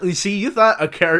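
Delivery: usual speaking voice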